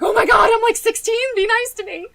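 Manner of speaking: high, loud whiny plea; imitating her panicked sixteen-year-old self begging; desperate pleading. Pleading teen about cops.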